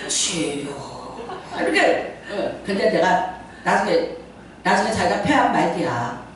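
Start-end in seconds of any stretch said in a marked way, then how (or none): 0.1-1.2 lower, very slow, hushed "주여"; hushed drawn-out prayer. Shown as a demonstration. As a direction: draw "주여" out slowly and softly. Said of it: "이렇게 해야 된다는 거야". (낮고 아주 느리게 "주여")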